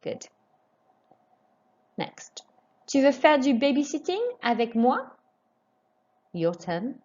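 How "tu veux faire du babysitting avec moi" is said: higher, careful model, moi lifts; rising question tune. Shown as a demonstration. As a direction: finish on a rise at moi. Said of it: "raise your voice just before the question mark".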